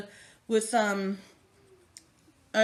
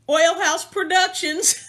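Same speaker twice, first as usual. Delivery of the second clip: excited shout-out; 8 semitones higher, 8 dB louder, slower than usual. Real, not acted. Thanking people, excited shout-out.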